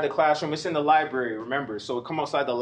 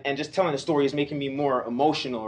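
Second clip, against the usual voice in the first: ordinary delivery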